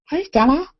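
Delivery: high, loud cooing hello to the dog. Real, not acted. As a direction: greet the dog in a sing-song. Greeting the dog.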